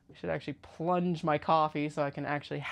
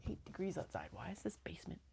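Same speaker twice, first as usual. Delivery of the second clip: whispering; 11 dB quieter, faster than usual. Real, not acted. Cold basement, muttering.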